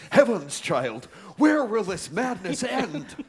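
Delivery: loud, high, aghast exclamation; shocked outcry. Acted. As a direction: gasp it out in horror. Shocked judge in witch trial.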